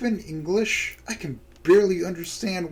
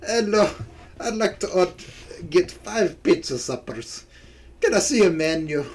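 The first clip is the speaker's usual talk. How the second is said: amateur Scottish customer voice; Scottish accent; 3 semitones higher than usual. Acted. Nessie ordering five pizza suppers, attempted Scottish accent. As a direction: put on a hard Scottish accent. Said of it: "I'll just do her lines one more time".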